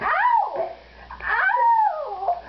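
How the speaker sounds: high yelp of pain; laughing yelps. Real, not acted. Hair caught in tape, yelping high.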